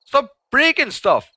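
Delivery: slow loud high yell at the game; frustrated yell. Real, not acted. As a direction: shout in exasperation. Yelling at game.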